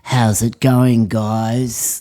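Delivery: slow, blokey, front-of-mouth greeting; butch voice. Shown as a demonstration. Coach demonstrating the butch voice to students. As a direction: drop the theatre, go full bloke. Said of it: "you get a very butch voice".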